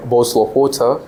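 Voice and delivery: Queen's accent, T clearly sounded in "water"; Queen's English accent. Shown as a demonstration. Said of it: "only Queen will speak like".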